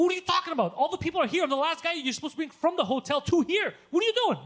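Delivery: high, loud retold tirade; worked-up, high-pitched scolding; exasperated rant. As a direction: lay into the driver in disbelief. Retold row with the shuttle driver.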